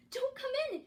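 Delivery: high, loud warning yell. A narrator's reading. Gertie warning Hansel and Gretel, yelling. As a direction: yell the warning to stop them. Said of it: "she yelled".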